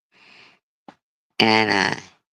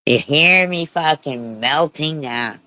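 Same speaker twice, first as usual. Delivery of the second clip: ordinary delivery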